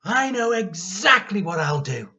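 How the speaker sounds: high, loud, triumphant whoop; mischievous boy's shout of glee; gleeful cry. Acted. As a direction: crow with mischievous delight. George's gleeful scheming outburst.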